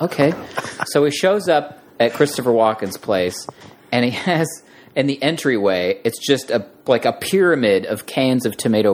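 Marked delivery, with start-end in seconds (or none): none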